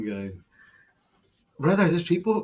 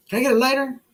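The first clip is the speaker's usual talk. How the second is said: raised, lightly mocking customer voice; 9 semitones higher, faster than usual. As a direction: ask in a high put-on tone. Customer voiced in retold video.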